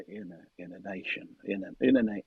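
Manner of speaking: low, soft, slow Texas drawl on "in a nation"; southern that up, low and lazy; Texas drawl demo. Shown as a demonstration. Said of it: "you gotta southern that up".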